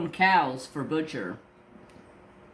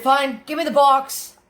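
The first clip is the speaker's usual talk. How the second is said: higher, louder snapped retort; fed-up retort; 11 semitones higher, 8 dB louder than usual. Retold or imitated. Telling the clerk to hand over the box, fed-up and loud. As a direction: snap it back at them.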